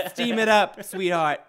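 calling out high and loud in a silly voice; loud put-on voice. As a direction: holler to Brent like a goofy sauna guest. Silly sauna skit.

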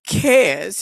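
high, slow, loud mock pity; hammed-up cooing over a little man; gushing sympathetic customer. Customer telling partner about the crying carrier, mock gushing.

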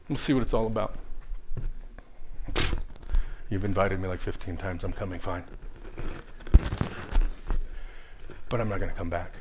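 low, very soft, grudging inner voice; hushed sceptic. Acted. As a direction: think it aloud quietly, like a reluctant guest. Voicing a sceptical churchgoer's thoughts.

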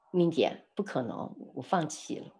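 quietly retold resignation, voice dropping away; imitating dejected surrender. Friend admitting to the speaker that they give up, dejected. Quoting friend who gives up.

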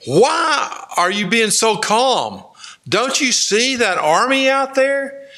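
high-pitched, hammed-up panicky protest; histrionic servant. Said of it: "a little more given to histrionics".

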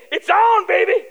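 high, loud throwdown; fighting challenge. Acted. Preacher voicing a challenge to the yelling man, high and loud. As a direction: call it out like starting a fight. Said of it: "that is saying".

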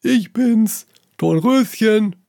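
put-on the wolf faking a little girl's voice; wolf at the door, disguising himself as a sweet girl; disguised voice. A narrator's reading. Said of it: "mit verstellter Stimme".

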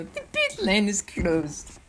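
slow, lightly voiced British accent; mock British accent. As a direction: drag out a mock British radio call. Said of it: "Lewis Hamilton came on the radio".

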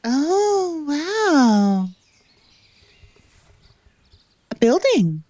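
high, drawn-out mock amazement; unimpressed, sarcastic wonder; lazy arrogant nobleman. NPC guide reacts dryly.

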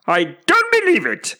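very high, loud bird screech; emphatic pigeon disbelief; disbelieving pigeon. A narrator's reading. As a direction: reject it in a squawk. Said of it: "said the".

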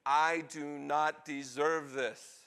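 each word drawn out at half speed; slow, emphatic. Acted. Weeping son to kind father, slow and emphatic. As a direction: stretch the plea out word by word.

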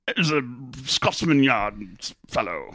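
put-on halting stab at a Scottish accent; mock Scottish accent. Host teasing the game master, mock Scottish accent. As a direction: badly fake a Scottish brogue, in pieces. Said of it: "I don't do a Scottish accent".

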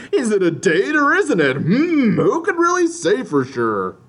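high, drawn-out mock coyness; coy mock voice. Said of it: "you would still be all coy like".

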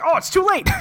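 high, sing-song "oh it's too late"; mock taunt. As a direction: tease it way up high. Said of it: "they're just like".

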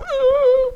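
very high pained cry-shout; distressed yelp. Retold or imitated. Kid crying out at the opticians from an eye drop. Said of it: "sometimes I'm like".

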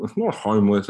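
usual speaking voice

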